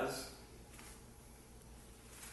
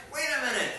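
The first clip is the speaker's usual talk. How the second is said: surprised; 9 semitones higher, 6 dB louder, much faster than usual. Acted. Preacher catches a scripture discrepancy.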